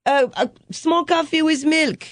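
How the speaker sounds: high-pitched little barista voice; imitated young son. Mother imitating her son serving customers, high child voice. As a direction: play a small child hurrying customers along. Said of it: "He tell the barista".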